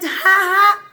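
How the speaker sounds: loud, high spoken "ha ha"; put-on laugh of ridicule; mocking laughter. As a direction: jeer like scornful ministers, play-acted. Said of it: "all the ministers started to laugh".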